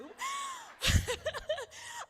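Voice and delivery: loud, high burst of laughter; a loud squealing laugh at her own tease; shrieking laugh. Real, not acted. Teasing about her group.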